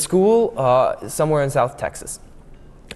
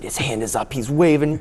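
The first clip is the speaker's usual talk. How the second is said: ordinary talk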